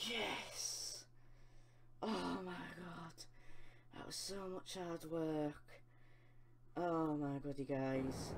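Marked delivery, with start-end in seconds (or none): 0.1-5.5 breathless relief. Real, not acted. Breathless after winning.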